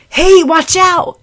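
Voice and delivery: loud, high, slow warning yell; warning shout. Acted. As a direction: shout to stop her in time. Warning cry in story.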